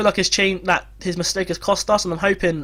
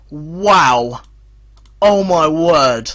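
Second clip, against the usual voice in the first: awed; 3 semitones lower, 5 dB louder, much slower than usual. Real, not acted. Recovering after the screaming.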